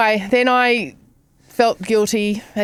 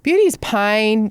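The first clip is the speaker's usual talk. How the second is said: mock solemn; slower than usual. Real, not acted. Echoing co-host slowly.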